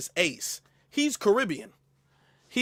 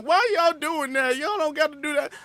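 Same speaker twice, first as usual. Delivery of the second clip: mocking complainers; 7 semitones higher than usual. Streamer mocking viewers who object, in a higher whine. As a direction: whine it back at them. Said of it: "y'all be like".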